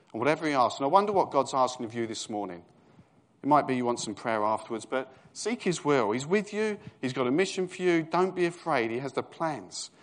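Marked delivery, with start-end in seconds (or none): 4.9-9.9 higher, louder urging; fervent preaching. Acted. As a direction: urge them warmly. Preaching encouragement.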